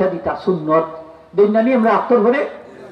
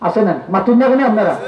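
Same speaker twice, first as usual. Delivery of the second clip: normal talking voice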